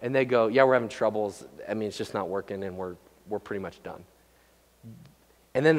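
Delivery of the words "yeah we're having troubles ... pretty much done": low, subdued, sinking softer to the end; calm and quiet. Acted. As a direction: play a resigned spouse, emotion drained away. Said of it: "like, just calm and quiet".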